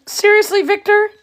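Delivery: high squeaky pup asking in disbelief; puppy voice. Pretend. Breeder speaking for a pup to its littermate, high puppy voice. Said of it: "He says".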